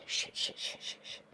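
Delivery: hushed, unvoiced rapid cursing; panicked whispering. Real, not acted. Player reacting to nearby roaring, whispered cursing. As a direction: whisper it under your breath, fading.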